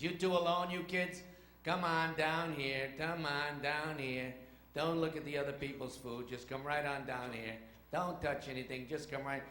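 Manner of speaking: curt, impatient waiter; rude waiter attitude. Acted. Waiter to two kids alone in restaurant, rude and impatient. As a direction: herd the kids along with open annoyance. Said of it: "They had that kind of attitude".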